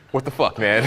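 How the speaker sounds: low, muttered disbelief, half laughing; bewildered inner voice. Retold or imitated. Comic telling what his brain says, bewildered. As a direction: let the disbelief sink low. Retold inner reaction, pitch dropped.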